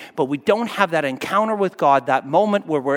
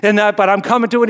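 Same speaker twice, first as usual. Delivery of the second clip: normal talking voice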